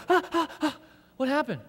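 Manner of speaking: high, startled waking wife. Retold or imitated. Wife woken by the shout, high and startled. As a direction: jolt awake and ask in alarm. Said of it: "my wife wakes up and goes".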